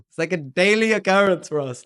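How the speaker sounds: high, loud mock disbelief; incredulous dismissal. Local friend answers the Mustang boast, incredulous. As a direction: imitate a baffled local brushing off the boast.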